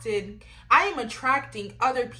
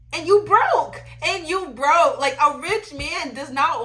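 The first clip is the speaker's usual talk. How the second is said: higher, louder mocking jab, said twice; imitating TikTok girl; 5 semitones higher, 7 dB louder than usual. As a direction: fire it off loud like the funny girl. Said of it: "she was like".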